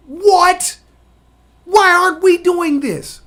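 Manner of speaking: loud, high-pitched astonishment; incredulous outcry. Acted. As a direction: blurt it out in loud disbelief. Voices astonished reaction to what he learned.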